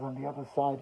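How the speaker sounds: soft, understated tearful retelling; subdued, mildly broken; retold crying. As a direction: quietly imitate a man in tears. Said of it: "he continued to cry".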